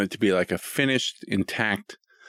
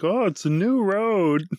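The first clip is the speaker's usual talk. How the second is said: delighted dad; 12 semitones higher, slower than usual. Retold or imitated. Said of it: "I'm like".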